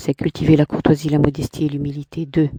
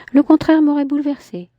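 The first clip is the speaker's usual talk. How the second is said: higher, lightly voiced woman; a hint of a proud woman; Clémentine's voice; 11 semitones higher, 3 dB louder than usual. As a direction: lift into her haughty tone. Man voicing woman in dialogue.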